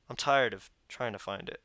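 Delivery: ordinary delivery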